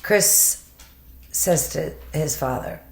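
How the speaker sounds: ordinary talk, nothing marked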